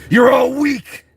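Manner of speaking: high, loud taunting shout; mock war shout. Rider storms in on campers, hammed-up shout. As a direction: yell it at them from horseback. Acting his horseback entrance.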